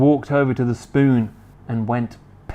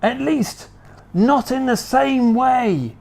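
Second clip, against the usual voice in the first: high, pressing and urgent; anxious Mister Cranky; 11 semitones higher than usual. Acted. Mister Cranky pressing George to tell him quick, anxious. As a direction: hurry him, fretting.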